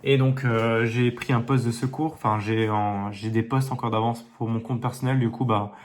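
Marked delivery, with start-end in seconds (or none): none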